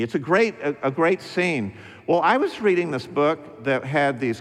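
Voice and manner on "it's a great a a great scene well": ordinary talk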